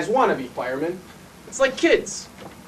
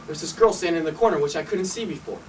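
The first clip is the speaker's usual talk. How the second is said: usual speaking voice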